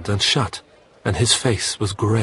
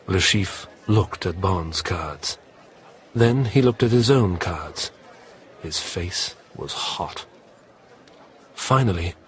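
usual speaking voice

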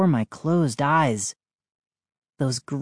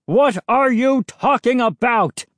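high, loud indignant shout; 9 semitones higher, 5 dB louder than usual. A narrator's reading. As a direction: shout back at the accusation. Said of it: "Mister McCall shouted".

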